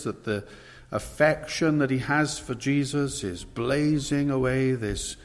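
ordinary talk

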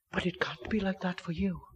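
urgent whispered warning. Acted. Preacher warning friend outside Christ, whispered. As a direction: drop to a whisper to the sinner.

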